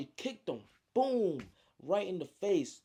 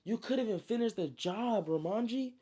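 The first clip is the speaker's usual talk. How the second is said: raised, pitched-up mock yell; 7 semitones higher than usual. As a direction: call him out, voice climbing higher. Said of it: "he yelled at him".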